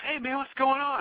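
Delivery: high-pitched imitation of a friendly guy; imitated stranger. Voicing the stranger's greeting.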